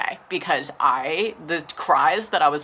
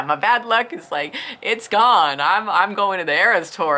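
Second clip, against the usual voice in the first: higher, louder, giddy; excited; 5 semitones higher, 5 dB louder than usual. Retold or imitated. Reliving scoring Eras Tour tickets, giddy and louder. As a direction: celebrate out loud, higher and louder.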